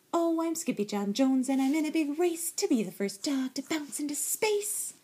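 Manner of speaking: high, bouncy kitten chant; excited boasting. A narrator's reading. Kitten playing space racer, excitedly boasting.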